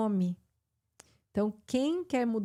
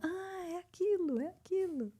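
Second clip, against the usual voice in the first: realization; 9 semitones higher, 4 dB quieter than usual. Pretend. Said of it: "você ouve e fala".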